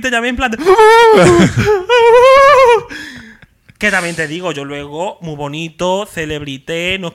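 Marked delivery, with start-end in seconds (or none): 0.6-3.2 high, loud crying laugh; laugh-scream with excitement; hysterical squealing laugh. Retold or imitated. Fan recalling her call to friend, squealing laugh.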